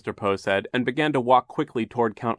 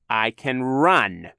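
slow, loud smug taunt; 4 semitones higher, 6 dB louder, much slower than usual. A narrator's reading. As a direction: savor the threat. Olaf taunting.

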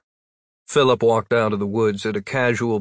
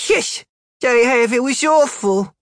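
put-on little girl voice; 13 semitones higher, 5 dB louder than usual.

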